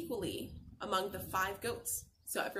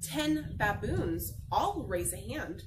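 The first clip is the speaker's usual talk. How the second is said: usual speaking voice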